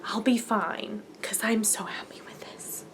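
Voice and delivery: soft whispered satisfaction; whispered contentment. Retold or imitated. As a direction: murmur it quietly, content. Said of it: "I was like".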